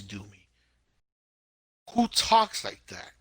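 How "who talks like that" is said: high, loud, slow incredulous question; exasperated disbelief. Real, not acted. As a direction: demand it in drawn-out exasperation. Exasperated at the show's dialogue.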